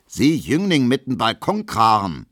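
high, loud Berlin outburst; indignant shout. A narrator's reading. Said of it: "schreit Gustav Schmelow". (wütend geschrien)

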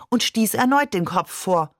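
usual speaking voice

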